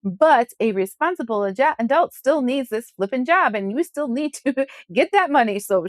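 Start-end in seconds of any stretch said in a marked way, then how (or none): none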